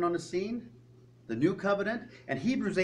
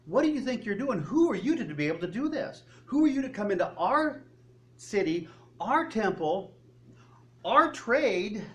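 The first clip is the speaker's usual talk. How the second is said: raised, high-pitched indignant demands; confrontive; 7 semitones higher, 3 dB louder than usual. Retold or imitated. Outraged temple crowd demanding what Jesus is doing, confrontive. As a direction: challenge him, punching each claim of ownership. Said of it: "this is a confrontive thing".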